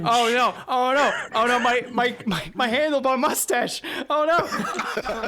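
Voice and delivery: high, fretful wailing; comic panic. Acted. Character fearing for his handlebar mustache, high comic panic.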